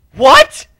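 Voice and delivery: high, loud shocked what. Acted. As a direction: squeal it in disbelief.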